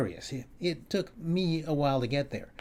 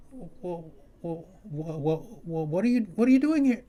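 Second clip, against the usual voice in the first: flustered stammer; 5 semitones higher than usual. Retold or imitated. Stammering at the intruder.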